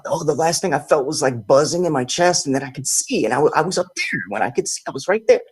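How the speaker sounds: rising, wondering friend; a hint of a stunned friend telling it; lightly voiced awed friend. Friend recounting out-of-body, voiced.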